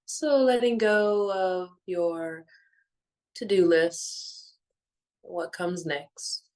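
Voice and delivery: measured, soothing meditation voice with long pauses; guided meditation. Real, not acted. Guiding listeners to let go of the day, calm meditation voice. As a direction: ease listeners gently into stillness, phrase by phrase.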